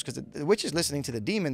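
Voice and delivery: normal talking voice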